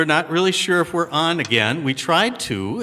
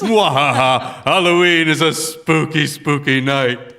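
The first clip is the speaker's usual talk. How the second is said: slow, hammed-up spooky villain cackle; sneering panto villain; 5 dB louder, slower than usual. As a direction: drawl it like a pantomime baddie. Spooky Halloween villain laugh.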